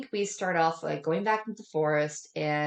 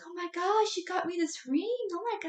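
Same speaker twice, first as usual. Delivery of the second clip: high-pitched mock girly gushing; hammed-up high "oh my gosh" voice; gushing girlfriend; 14 semitones higher than usual. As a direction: squeal it like a thrilled girlfriend. Voicing a giddy girlfriend in retelling.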